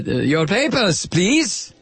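mock German accent, rising on the end; movie German accent. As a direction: demand it in a Hollywood German accent. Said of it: "would consider a German accent".

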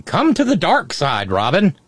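playfully sinister invitation; mock tempter. Imagined activist email luring Robin, lightly mock tempting.

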